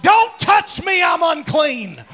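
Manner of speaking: high, loud panicked yell; don't touch me, yelled high and loud; frantic shout. Retold or imitated. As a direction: shriek it out like a man in disgrace. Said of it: "I'm like".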